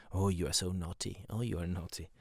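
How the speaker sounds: low, soft teasing scolding; soft insult. Retold or imitated. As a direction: re-enact a gentle, half-hearted insult, pitched low. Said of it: "soft insult".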